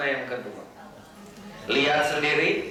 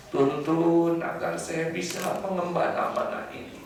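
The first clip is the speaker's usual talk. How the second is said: humble prayer; 2 semitones lower, 3 dB quieter than usual. Acted. A father praying over his small child, soft and contrite. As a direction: beg forgiveness quietly from the heart. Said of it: "seorang bapak yang nggak pandai bicara".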